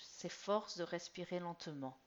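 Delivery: plain reading voice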